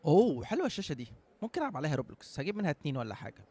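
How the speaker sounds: ordinary talk, nothing marked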